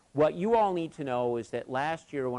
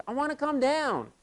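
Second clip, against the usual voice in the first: put-on a higher, small voice of a frightened kid; imitating scared boy; 14 semitones higher than usual. Frightened boy on the cable answers the coach, high and small. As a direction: sound like a boy who wants down.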